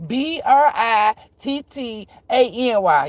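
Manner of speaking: letters spelled slowly and loud; spelling it out. Shown as a demonstration. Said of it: "how you spell my name?".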